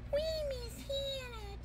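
high, very soft whine; crying baby doll. Pretend. Baby dolls missing Hannah, high soft pretend cry. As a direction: whimper it as a tiny pretend baby. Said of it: "One baby go".

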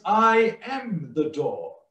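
slow, "am" drawn out against "was" and "will be"; stress on AM. Shown as a demonstration. Preacher contrasting present with past, stress on "am". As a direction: hold "am" firmly, then fall on "door". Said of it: "He does not say".

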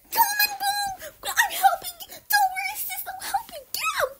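high, loud yelling; excited rescue shout. Pretend. Sister rescuing sibling, shouting high and loud. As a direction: shout it over the water.

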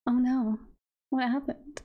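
usual speaking voice

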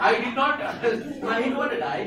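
put-on high, loud, giggling child's protest; mock child pleading while cracking up; laughing child voice. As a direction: play a whiny kid through laughter.

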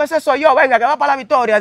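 agudo y fuerte, contestando al guardia; respuesta en voz alta. Retold or imitated. Responde al llamado. (respuesta alta y aguda al guardia)

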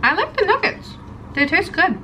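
speaking between bites; talking while chewing. Real, not acted. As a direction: mouth partly full. Seen 1: she bit nugget at -5, jaw works, lips pressed between words.

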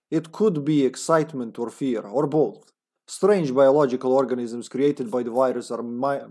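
put-on comrade Russian accent. Agent's journal about a final plan, Russian accent. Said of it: "a comrade accent".

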